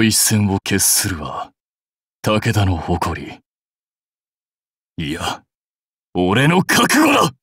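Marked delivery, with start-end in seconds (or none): 6.1-7.3 high, rising warrior cry; forceful yell, pitched up; defiant shout. Acted. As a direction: declare it at full force.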